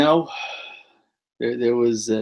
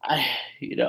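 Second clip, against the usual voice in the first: whisper; 7 dB quieter than usual. Real, not acted. Hushed aside before serious point.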